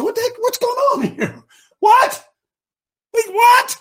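high, bewildered cries of disbelief; David can't believe what he hears. Acted. Preacher voicing David, baffled and crying out.

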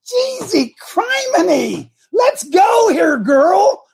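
high, slow, drawn-out yell of frustration; mock exasperated shout. Coach yelling at Myra to speed up, slow high shout. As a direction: hurry her up, half-joking bellow. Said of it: "And I'm thinking".

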